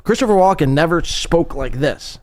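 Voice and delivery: ordinary talk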